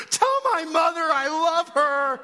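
high, loud, wailed mock plea; tearful cry. Tough kid pleading to counselor with tears, mock wail. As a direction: imitate a terrified kid wailing last words. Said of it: "he's just got tears in his eyes".

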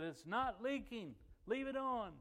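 high, drawn-out announcement; retold calling out. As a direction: call the good news out to a helper. Calling out the good news.

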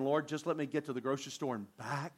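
quiet pleading prayer. Retold or imitated. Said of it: "You're just going".

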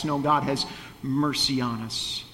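ordinary talk, nothing marked

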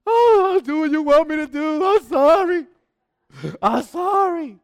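high, loud desperate pleading; begging for my life. Acted. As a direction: plead like a man swallowed whole. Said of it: "Jonah begging for his life".

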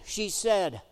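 ordinary talk, nothing marked

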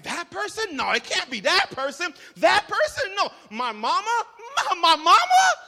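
building to a loud laughing shout on mama; comic growing disbelief; mock suspicion. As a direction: suspect everyone, then yell in disbelief. Imagining suspects, ends shouting.

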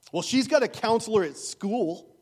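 lightly voiced father shrugging off the pastor; dismissive father. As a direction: wave it away like a father who doesn't want the problem. Dismissive father Kevin retorts.

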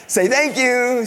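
put-on high, loud child parroting "Say thank you"; son imitation. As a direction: recite it word for word like the kid. Mimicking literal son.

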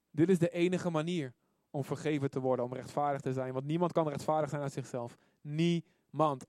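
ordinary delivery

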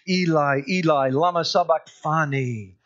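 mildly acted anguished cry; crying out loudly. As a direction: call out to God, moderately loud. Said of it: "cried out in a loud voice".

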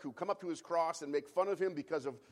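ordinary delivery

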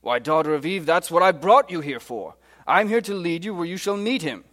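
rousing British Mister Beaver; animated, rising British character voice; British accent. Acted. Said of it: "in my very best British accent".

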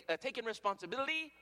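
usual speaking voice

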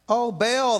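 a desperate plea, voice lifted high; crying out. A narrator's reading. Prophets of Baal crying to their god, loud and high. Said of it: "they cried".

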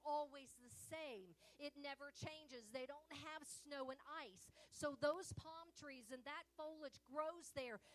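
loud, driving sermon voice; a loud warning to the congregation; full-force preaching. Acted. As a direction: hammer the warning home.

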